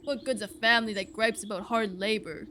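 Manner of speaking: resentful ox character voice; bitter ox farmer. Acted. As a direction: grumble like a surly farming ox. Plays the ox farmer.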